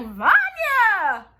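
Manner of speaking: high, drawn-out hail; exaggerated shout of greeting; mock calling out. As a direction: call the name out long and high, hamming it up. Luther calling out to Vanya.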